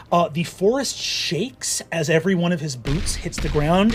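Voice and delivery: ordinary talk, nothing marked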